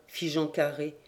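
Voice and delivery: plain reading voice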